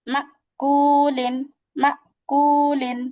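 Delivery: মা কুলিন drawn out very slowly, each sound held; slow recitation demo. Shown as a demonstration. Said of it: "হামজার মাখরাজ যেহেতু আমরা শিখবো".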